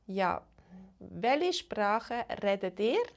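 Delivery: usual speaking voice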